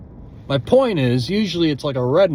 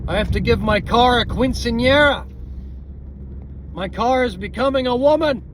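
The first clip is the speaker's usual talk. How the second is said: playful; 8 semitones higher than usual. Real, not acted. Joking about car's age.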